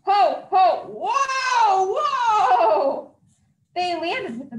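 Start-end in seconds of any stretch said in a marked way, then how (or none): 0.1-3.2 loud laughing whoa, pitched up; loud, laughing Santa call. Acted. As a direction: shout it out while laughing at the landing. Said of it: "Santa called out".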